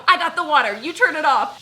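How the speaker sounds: loud urgent order to dad; urgent shout. Retold or imitated. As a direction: shout across the bathroom to grab the water. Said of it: "So I go".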